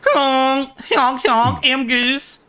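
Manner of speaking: very high goose-like honking; imitated honk. Playing at car honks.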